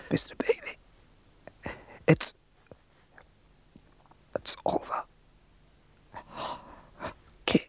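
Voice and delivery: faint, broken voiceless whisper with long pauses; dying whisper. Retold or imitated. Dying friend whispering his last message, faint whisper. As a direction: breathe the words out like your last. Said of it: "Er sagt".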